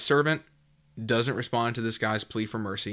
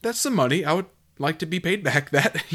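much higher, amused mock reasonable tone; imagined creditor; 7 semitones higher than usual. Preacher voicing someone owed money, wryly amused. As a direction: voice a lender who wants the money back, half laughing. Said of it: "you're like".

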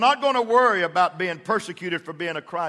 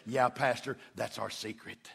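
low, hushed mock-smug aside; smug confiding; 5 semitones lower, 10 dB quieter than usual. Men confiding in their pastor, smug and hushed. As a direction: imitate a man sharing a secret, very softly. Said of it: "that little smug look and say".

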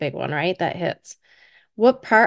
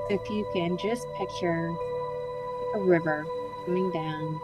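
guided meditation; 7 dB quieter than usual. Acted. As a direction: gently guide them into calm relaxation.

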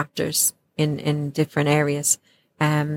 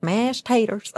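higher-pitched, mock Alabama accent; 5 semitones higher, slower than usual. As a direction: lightly imitate an Alabama drawl. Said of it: "who was from Alabama".

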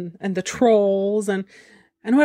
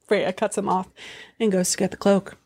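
ordinary talk, nothing marked

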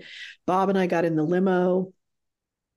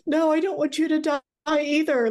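tearful plea; 9 semitones higher than usual. Retold or imitated. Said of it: "crying, driving".

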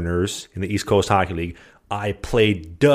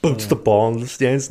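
ordinary talk

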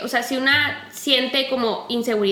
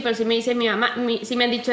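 ordinary delivery

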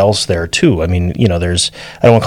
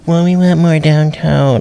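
high, slow mocking troll; internet troll voice; 7 semitones higher, slower than usual. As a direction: whine it like an online commenter. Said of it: "that's my internet, uh, troll voice".

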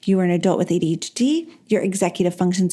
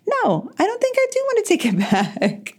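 laughing; 12 semitones higher than usual. Real, not acted. Laughs off her bold claim.

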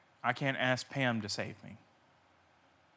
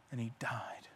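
very soft, low and grave; hushed, lowered storytelling voice; hushed solemnity; 4 semitones lower, 10 dB quieter than usual. Real, not acted. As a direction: let it sink almost to a whisper. Solemn telling of the crucifixion death.

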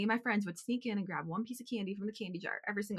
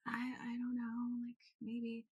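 much softer, slow guilty mumble; sheepish mumble; 11 dB quieter, slower than usual. Retold or imitated. Daughter to angry dad, soft sheepish mumble. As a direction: trail off guiltily. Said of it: "And I was like".

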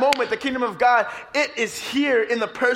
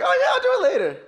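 high-pitched mock excuse, louder and jumpy; breezy brush-off; 11 semitones higher, 3 dB louder, faster than usual. Preacher voicing people putting things off, breezy brush-off. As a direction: wave it away in a high, silly voice.

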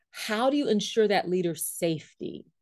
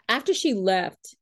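usual speaking voice

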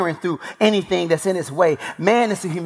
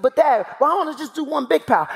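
put-on questioning young son; 7 semitones higher than usual. Young son questions father's instructions.

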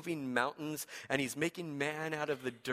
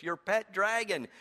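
delighted repeat; 3 semitones higher than usual. Shown as a demonstration. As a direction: relish "Your pet dragon" with glee. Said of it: "I love the way that Eugene Peterson says this".

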